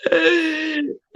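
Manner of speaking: breaks into a big high laugh; burst of laughter. Real, not acted. Laughs at own list.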